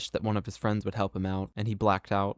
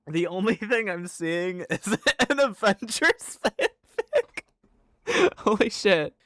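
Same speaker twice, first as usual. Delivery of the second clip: high, helpless laughter through the words; cracking up, pitch climbing; laughing; 11 semitones higher than usual. Real, not acted. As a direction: talk through a real laughing fit. Laughing at search result.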